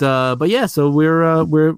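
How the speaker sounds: usual speaking voice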